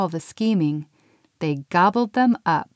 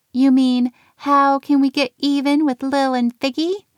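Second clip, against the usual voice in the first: higher, louder sly elf; scheming elf voice; 7 semitones higher, 5 dB louder than usual. A narrator's reading. Pixie to Nim, a higher sly elf voice. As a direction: relish the mischief.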